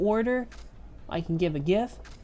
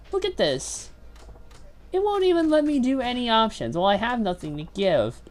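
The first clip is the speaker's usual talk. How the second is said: normal talking voice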